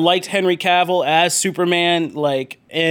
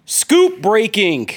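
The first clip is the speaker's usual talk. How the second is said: show announcer intro; 4 semitones higher than usual. Acted. Host introducing co-hosts to the listeners, announcer hype. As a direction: stretch out the big titles like an announcer.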